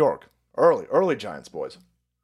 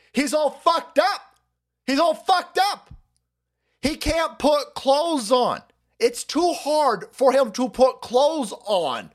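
much higher, slow and scornful; mocking high drawl; 8 semitones higher, slower than usual. Real, not acted. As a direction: stretch each word out in high-pitched contempt. Ranting, mocking the senator.

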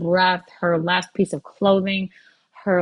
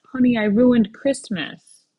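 mild weeping; 5 semitones higher, 4 dB louder than usual. Retold or imitated.

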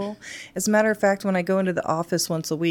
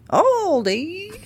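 excited; 13 semitones higher, 4 dB louder than usual. Real, not acted. Excited shout of store name.

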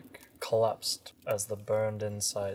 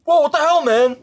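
much higher, louder shocked cry; shocked teen; 16 dB louder, much faster than usual. Acted. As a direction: react like a horrified teen.

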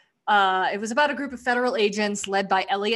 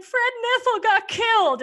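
high, loud whiny wail; mock upset Lucy; 12 semitones higher, 5 dB louder than usual. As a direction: imitate Lucy's upset crying voice.

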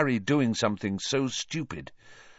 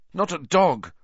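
higher-pitched moan of pain; 7 semitones higher, 4 dB louder than usual. A narrator's reading. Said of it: "Ron moaned".

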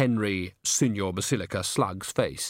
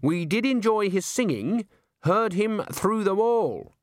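slow, high sugary lady; fake-gracious old woman; sweetly polite; 10 semitones higher, slower than usual. A narrator's reading. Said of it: "said the thin man primly".

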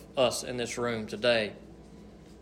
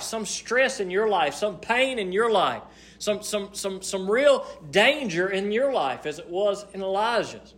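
raised, urgent sermon delivery; impassioned preaching; 8 semitones higher than usual. Real, not acted. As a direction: press it on the congregation insistently.

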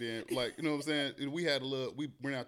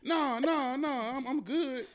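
mock drunk refusal; 11 semitones higher, 3 dB louder, much slower than usual. His teary drunk refusal retold.

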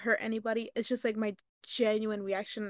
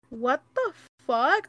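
slow, louder what the fuck; outraged disbelief; 3 semitones higher, 7 dB louder, slower than usual. Retold or imitated. Telling friends about the greedy girl, slow shocked outrage. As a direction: stretch it out in shock. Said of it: "And I'm like".

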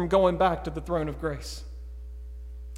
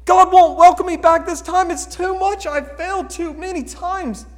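higher, despairing voice; imitating a guilty listener; 7 semitones higher, 3 dB louder than usual. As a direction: voice someone who feels beyond forgiveness. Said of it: "You say".

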